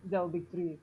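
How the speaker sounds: ordinary delivery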